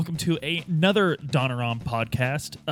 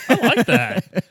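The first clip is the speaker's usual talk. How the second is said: louder, higher enthusiastic repeat; excited; 10 semitones higher, 8 dB louder, slower than usual. Real, not acted. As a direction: say it again with delight. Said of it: "repeats louder".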